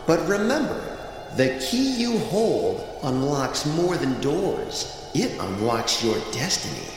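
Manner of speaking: soft mystical voice. Acted. Shell gives final message.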